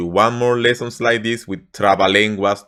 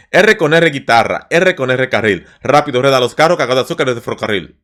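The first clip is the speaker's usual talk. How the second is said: fast, rushed tongue twister; tongue twister speeding up; 4 dB louder, faster than usual. Shown as a demonstration. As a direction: rattle it off quickly. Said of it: "let's try to do it this faster".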